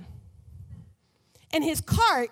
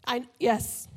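ordinary talk

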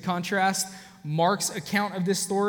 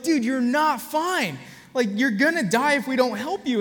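urgent plea; 7 semitones higher, 5 dB louder than usual. Retold or imitated. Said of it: "We're like".

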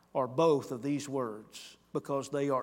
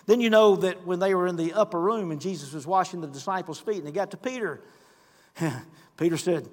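ordinary delivery

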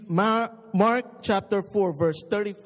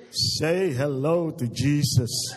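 slow, lower, menacing parrot croak; 5 semitones lower than usual. Acted. Bird in the dark warns the burglar in a slow low parrot voice. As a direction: drawl it like a sinister talking bird. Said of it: "And the bird said".

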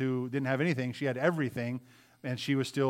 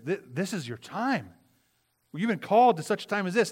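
urgent charge; 6 semitones higher, 4 dB louder than usual. Retold or imitated. Mordecai urges Esther to act.

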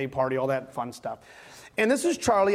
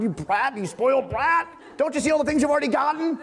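higher, building exasperated parent's scolding; mock frustration; 8 semitones higher than usual. Frustrated parent to a child opening gifts, mock exasperated scolding. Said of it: "we'd get frustrated and be like".

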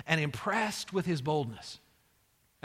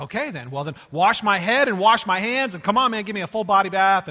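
eager, louder outburst; without hesitation; 8 dB louder than usual. Retold or imitated. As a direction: jump in at once, building to loud insistence. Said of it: "without hesitation".

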